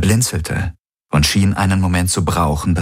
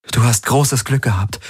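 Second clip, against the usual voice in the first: put-on raised higher, gentle young woman; Kirai's voice; 5 semitones higher than usual. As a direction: speak as Kirai, caring for the wounded.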